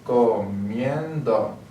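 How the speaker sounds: "Comiendo" drawn out slowly, leaning on "mien"; stressed syllable shown. Shown as a demonstration. Teacher showing learners where the stressed syllable is, "Comiendo" slow with stress on "mien". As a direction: stretch "Comiendo" to show the stress on its middle syllable. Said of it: "where the stressed syllable is".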